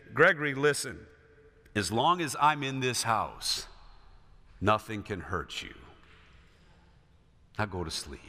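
calm and protect, slowing into quiet; reassuring father. Retold or imitated. Father comforting his frightened son at bedtime, tender. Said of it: "he said".

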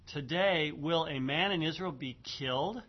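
KILLED rising clearly into a question; question tune. Shown as a demonstration. Teacher rereading the translated verse to students, question rising on KILLED. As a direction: read it out as a question, lifting on KILLED. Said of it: "it comes in the form of a question".

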